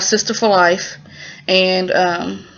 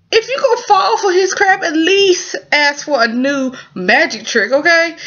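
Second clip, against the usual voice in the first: exasperated; 8 semitones higher than usual. Real, not acted. Viewer scolding the shop lady for falling for it, high. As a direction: plead with the character in disbelief. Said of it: "viewer scolding the character".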